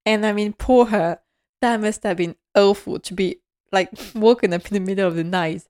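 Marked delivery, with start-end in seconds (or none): none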